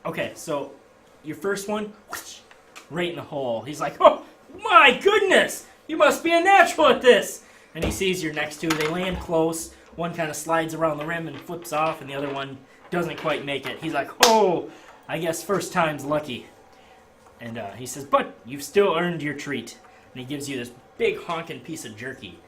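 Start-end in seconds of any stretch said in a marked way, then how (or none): 4.0-7.4 amazed barker. Acted. 14.2-16.5 slow, louder consoling quip; barker. Acted. Barker after misses. 18.0-19.8 slow, louder consoling quip; barker. Acted. Barker giving prize.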